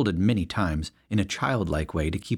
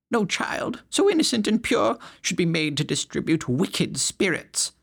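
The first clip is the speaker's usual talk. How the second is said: much higher, prim pious voice; pious lady; 10 semitones higher than usual. A narrator's reading. Temperance lady lecturing the crowd, high prim voice. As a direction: sermonize like a prim churchwoman.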